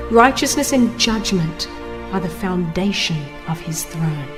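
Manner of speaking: usual speaking voice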